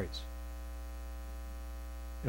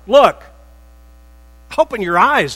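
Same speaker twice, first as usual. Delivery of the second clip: indignant son; 6 semitones higher, 10 dB louder than usual. Acted. Older son complaining to father.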